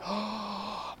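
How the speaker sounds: hammed-up collective gasp; a church crowd's mock gasp; mock shocked gasp. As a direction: gasp like a scandalized congregation. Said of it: "they're like".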